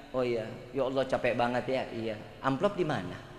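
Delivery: quiet, low, gentle imitation of a wife; a wife sympathising then asking for the envelope; soft wife voice. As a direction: hush it like a sweet caring wife.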